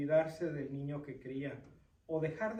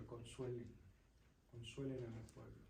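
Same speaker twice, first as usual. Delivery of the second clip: much softer, lower murmur of "consuelen"; soft, tender plea; 4 semitones lower, 12 dB quieter than usual. Acted. God pleading for his people, soft tender repeat. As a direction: drop to a soft, gentle "consuelen, consuelen".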